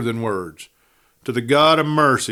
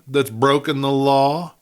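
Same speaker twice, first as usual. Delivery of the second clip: dry question; 5 semitones higher, 4 dB louder than usual. A narrator's reading. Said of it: "asked the doctor dryly".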